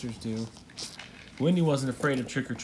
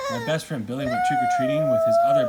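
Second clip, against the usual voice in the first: shouting over noise; 8 dB louder, faster than usual. Real, not acted. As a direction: shout the line above the racket. Narrator shouting over interruptions.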